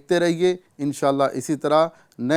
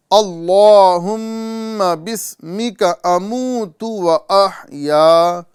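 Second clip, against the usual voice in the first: very slow, higher, louder chanted "اللہم بسمک اموت و احیا"; slow recitation; 4 semitones higher, 6 dB louder, much slower than usual. Shown as a demonstration. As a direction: recite each syllable stretched for students to copy. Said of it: "آپ بھی ساتھ ساتھ پڑھنے کی کوشش کریں".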